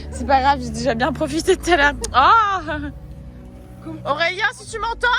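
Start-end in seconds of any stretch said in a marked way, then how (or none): 2.1-5.1 high, slow, hammed-up lament; mock dramatic farewell. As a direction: cry out to a lost love. Mock tragic farewell to Aurélien.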